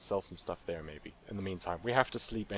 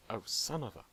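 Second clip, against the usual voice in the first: normal talking voice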